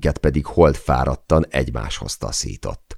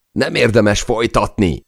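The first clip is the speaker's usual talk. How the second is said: yelled high over the storm; a desperate shout above crashing waves; shouting in his ear; 8 semitones higher, 4 dB louder than usual. Acted. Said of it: "üvöltötte a fülembe Chay".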